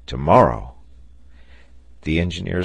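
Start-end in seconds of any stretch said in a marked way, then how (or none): 0.1-0.8 low, firm, falling answer; settled, emphatic reply; firm confirmation. A narrator's reading. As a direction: confirm it decisively, pitch dropping. Captain confirms the sailor's question.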